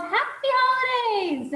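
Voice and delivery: high, drawn-out friendly holiday shout; cheerful retold yell.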